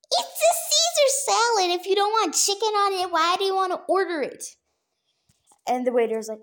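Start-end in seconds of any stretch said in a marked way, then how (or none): 0.1-4.6 exasperated retort. Retold or imitated. Imitated waiter retort.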